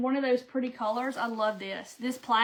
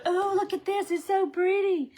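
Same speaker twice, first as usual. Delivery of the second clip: higher, delighted gush; gushing over fabric; 8 semitones higher than usual. Retold or imitated. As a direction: swoon over the bolt of cloth. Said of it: "you just wanna go".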